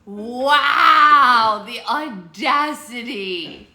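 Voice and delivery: loud, drawn-out theatrical disbelief; mock outrage. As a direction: stretch it out, scandalised for show.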